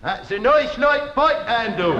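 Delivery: "That's a nice light pike handle" with broad "noice loight" vowels; broad peasant accent. Acted. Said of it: "Listen, boy, listen".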